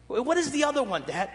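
a hint of a curious little daughter; high, eager question to Dad; imitating his daughter. Daughter asking again.

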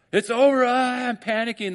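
high, loud mock-panicked outburst. Preacher acting out a panicking mind, mock panic. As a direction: play a hammed-up freak-out.

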